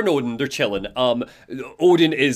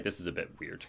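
low, very soft aside; muttered unease; 7 semitones lower, 16 dB quieter, faster than usual. Acted. Alan privately unsettled as he leaves, low quiet mutter. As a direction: drop it right down, as if to himself. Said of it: "he's probably like".

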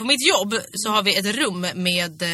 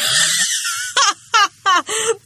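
high, loud laughing scream; shrieking laugh; 7 dB louder than usual. Real, not acted. Friend hearing the vibrator story, real screaming laugh. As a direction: burst into a shocked shrieking laugh.

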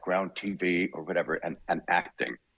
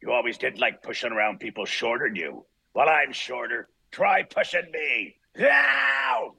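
Wolverine audition line; 3 semitones higher, slower than usual. Shown as a demonstration. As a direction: snarl the insult, then a sudden pained yelp. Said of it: "I said the line, and this line was".

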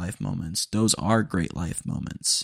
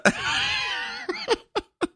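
bursting into laughter. Real, not acted. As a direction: explode laughing at your own impression. Cracks up at own impression.

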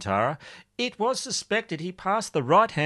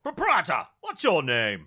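exasperated squire, pitched up; pompous husband calling out; blustering gentleman; 2 semitones higher than usual. Acted. Rickett calls to his wife Bertha about his missing coat, blustering.

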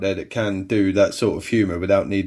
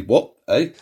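ordinary talk, nothing marked

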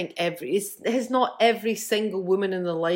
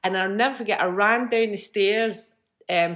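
normal talking voice

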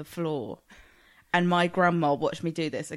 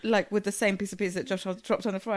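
normal talking voice

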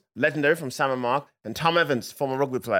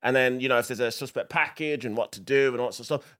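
normal talking voice

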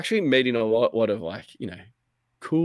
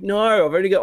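high, loud retold outburst; dismayed protest; 9 semitones higher, 6 dB louder, faster than usual. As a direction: burst out in mock panic. Protests ship coming for his chests.

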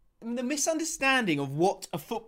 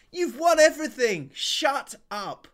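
exasperated rant; 10 semitones higher than usual. Real, not acted. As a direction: scold the rival fans in disbelief. Ranting at Arsenal fans.

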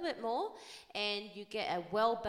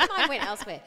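laughing; 6 semitones higher, 13 dB louder, faster than usual. Real, not acted. Laughing admission.

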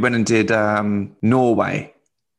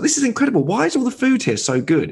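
higher-pitched mock amazement; retold amazement; 9 semitones higher, faster than usual. Comic telling backstage worker he is amazed by the food, higher-pitched amazement. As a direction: act out being amazed, pitch lifted.

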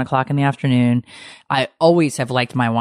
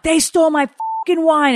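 retold outrage; 13 semitones higher than usual. Wife shrieking to friend that wine was stolen, high mock fury. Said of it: "And I'm like".

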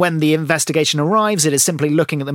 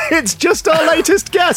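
slow, higher, laughing through it; laughing joke; 10 semitones higher than usual. Real, not acted. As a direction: crack up while delivering the gag. Joking about fly on air.

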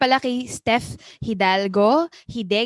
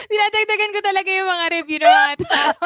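laughing; 9 semitones higher, 4 dB louder than usual. Real, not acted. Laughing while talking.

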